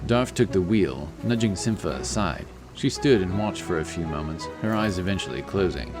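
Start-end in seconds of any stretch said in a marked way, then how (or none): none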